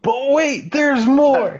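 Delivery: high, hammed-up TV pitchman; infomercial voice. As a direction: sell it like a late-night infomercial host. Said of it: "Just like an infomercial".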